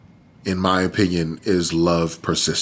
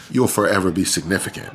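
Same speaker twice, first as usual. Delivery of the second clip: normal talking voice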